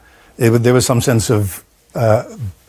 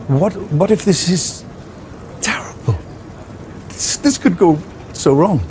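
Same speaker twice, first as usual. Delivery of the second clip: higher, jittery retold panic, the word terrible barely whispered; anxious inner panic; 7 semitones higher, 4 dB quieter than usual. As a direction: relive the sudden dread of having to perform.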